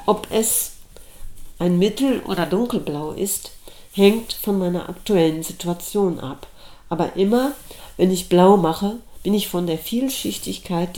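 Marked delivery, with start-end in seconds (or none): none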